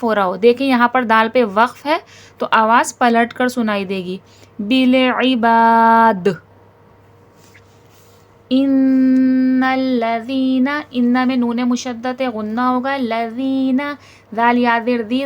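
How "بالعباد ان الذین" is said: very slow, measured chant; drawn-out tajweed chant; Quranic recitation. Shown as a demonstration. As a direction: recite slowly, giving each letter its due. Teacher recites the verse.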